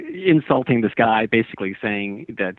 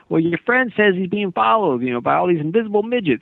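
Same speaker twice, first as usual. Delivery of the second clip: higher, lightly imitated Southern caller; a hint of Southern twang, pitched up; Southern accent; 6 semitones higher than usual. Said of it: "this guy with a Southern accent".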